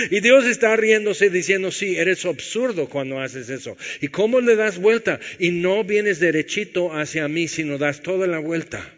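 a pastor preaching with rising fire; full-force preaching. Acted. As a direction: preach it hard to the congregation.

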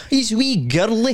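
high, slow mimicked caller; phone-in caller. Mimicking the caller who kept saying girly, high drawn-out impression. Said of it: "And he kept saying girly".

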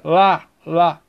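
"Lá" said alone, twice, as the strong syllable; stressed syllable. Shown as a demonstration. Teacher asking for the tonic syllable of lápis, stressed syllable isolated. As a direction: punch out "Lá" as a separate beat. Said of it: "sílaba tônica".

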